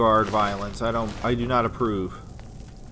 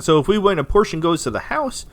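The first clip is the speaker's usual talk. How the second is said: emphatic; 8 semitones higher, 8 dB louder than usual. Acted. Reads out line with emphasis.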